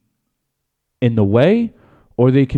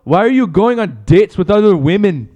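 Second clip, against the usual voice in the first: higher mock accusing wife; Conor's wife; 5 semitones higher, 4 dB louder than usual. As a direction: demand answers in a put-on accent. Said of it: "you Connor's wife".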